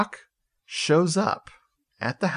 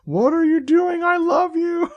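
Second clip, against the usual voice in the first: squeaky, much higher pleading goat; confused goat voice; 4 dB louder than usual. Acted. Goat begging its killer, squeaky and much higher. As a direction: plead like a betrayed pet goat.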